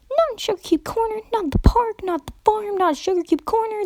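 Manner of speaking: high-pitched scatterbrained pony rambling; rambling Pinkie Pie. Acted. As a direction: rattle off the places in a high giddy voice. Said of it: "the pony rambled".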